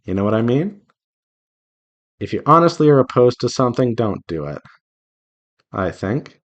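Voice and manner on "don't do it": ordinary talk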